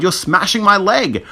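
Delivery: high-pitched protest; distressed, urgent wail; pained cry. A narrator's reading. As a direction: complain in pain and alarm. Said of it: "I cried".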